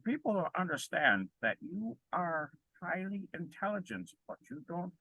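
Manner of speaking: soft, high-pitched mimicry of an intellectual; Jordan Peterson. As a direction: drop the volume and imitate a pseudo-intellectual. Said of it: "incredible Jordan Peterson impression".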